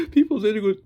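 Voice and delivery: gasp, then high, loud, rushed mock sob; crying student voice. Showing how the student talks, mock crying. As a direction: imitate tearful blubbering, fast and high. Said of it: "It's always like this crying voice".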